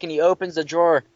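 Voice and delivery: ordinary talk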